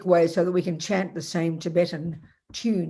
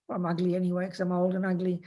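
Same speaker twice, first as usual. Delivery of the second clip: ordinary talk, nothing marked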